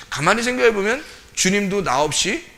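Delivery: ordinary delivery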